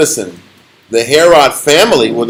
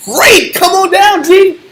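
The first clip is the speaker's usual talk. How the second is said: high, drawn-out mock delight; slow, high-pitched retold dare; taunting welcome; 13 semitones higher, slower than usual. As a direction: goad the caller with fake eagerness. Retells taunting the angry caller.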